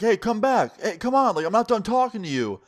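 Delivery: mock calling-out, pitched well up; imitated yelling. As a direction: yell after someone who is leaving. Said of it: "he's yelling".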